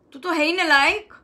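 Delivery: wrong way: sharp put-down; harsh scolding. Shown as a demonstration. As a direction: snap at yourself like a scolding elder. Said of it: "ऐसे नहीं बोल रहे".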